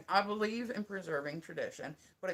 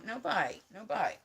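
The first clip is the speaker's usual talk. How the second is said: ordinary talk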